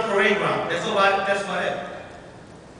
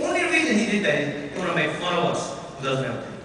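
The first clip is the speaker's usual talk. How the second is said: ordinary talk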